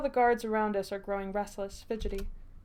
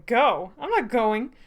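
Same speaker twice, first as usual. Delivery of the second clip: louder, mildly acted indignation; indignant refusal; 4 semitones higher, 6 dB louder than usual. A narrator's reading. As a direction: refuse with a touch of outrage. Orlom indignantly refuses to leave.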